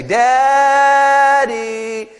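put-on loud, high, drawn-out kid's call; child calling out. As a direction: mimic a child hollering for a parent in sing-song. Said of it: "my kids almost always say".

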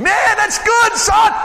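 high, loud delighted shout; God whooping with joy; thrilled God. Acted. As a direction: cheer him on like a proud father. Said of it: "God was just so thrilled with David".

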